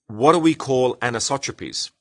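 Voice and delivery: normal talking voice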